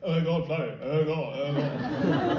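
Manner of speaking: mock London mumble, vowels only; London accent. Londoners fretting over flooding, mock London accent. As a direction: slur it into a London accent without consonants. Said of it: "that's the overview of how people talk in London".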